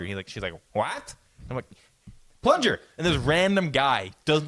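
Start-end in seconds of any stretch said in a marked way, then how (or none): 0.7-1.1 high-pitched imitated query; puzzled, rising high voice; baffled shop lady. Shop lady baffled a second time. 2.4-2.8 high, louder exasperated retelling; mock frustrated call; exasperated repeat. Repeats it louder after not being understood.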